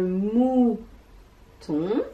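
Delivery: "mouton" as long mou... then rising ton; very slow "mouton", broken in the middle; split into syllables. Shown as a demonstration. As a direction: sound out "mouton" for young learners. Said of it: "des syllabes qui composent".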